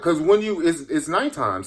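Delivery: ordinary delivery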